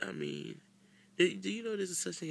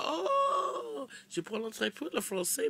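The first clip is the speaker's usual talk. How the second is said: put-on French accent; 8 semitones higher, 4 dB louder, slower than usual. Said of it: "they hear you hitting them accents".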